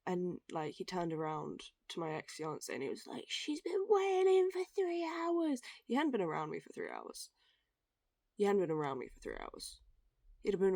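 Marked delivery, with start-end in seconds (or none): 3.3-5.6 much higher, slow whiny mimic of a complaining coworker; mocking coworker. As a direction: parody his whinge with a nasal sneer.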